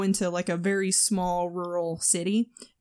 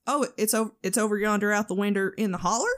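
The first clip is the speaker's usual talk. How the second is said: quick mimicked Southern accent, "winder", "holler"; teasing Southern accent; faster than usual. Daughter shooting back at mom, quick mock Southern accent. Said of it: "I'll usually shoot back with".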